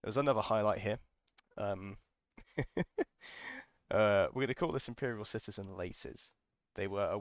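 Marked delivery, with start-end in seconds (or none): 2.4-3.6 breathy laugh. Real, not acted. Laughing at the memory.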